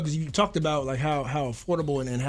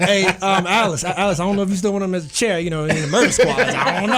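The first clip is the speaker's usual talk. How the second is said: louder and higher, laughing while teasing; teasing through laughter; 5 semitones higher, 8 dB louder than usual. Real, not acted. Teasing the host about the guest's old rap group, laughing. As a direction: tease him, barely holding back laughs.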